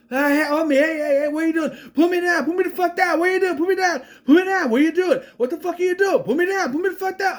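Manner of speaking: high, frantic, repetitive hollering; mock panicked yelling. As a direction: imitate a man yelling in rapid protest. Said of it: "keeps yelling shit".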